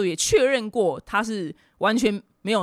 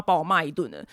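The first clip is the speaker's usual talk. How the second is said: ordinary talk, nothing marked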